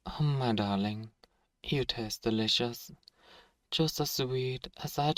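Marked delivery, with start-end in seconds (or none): none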